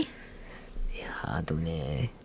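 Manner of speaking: slow, breathy hesitation; whisper. Real, not acted. Friend hedging on miso noodles, whispered. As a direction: breathe it out slowly.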